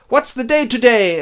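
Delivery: high, loud overexcited call; shouting out. Acted. Scrooge calls from his window, shouting excitedly. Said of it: "finds that it is broad daylight and shouts".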